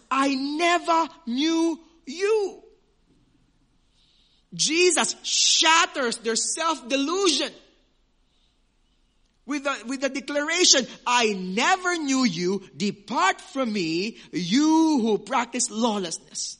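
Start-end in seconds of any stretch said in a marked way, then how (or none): 0.1-2.6 very slow, loud and high, each word hammered; forceful declaration. Acted. A preacher shows the force of Jesus's words, slow and loud. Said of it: "Look at that force". 11.0-16.6 very slow, loud and high, each word hammered; forceful declaration. Acted. A preacher shows the force of Jesus's words, slow and loud.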